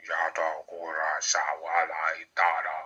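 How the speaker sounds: low, soft, slow incantation; solemn incantation. Real, not acted. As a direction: intone it low and slowly, like an invocation. Incantation during a spiritual invocation.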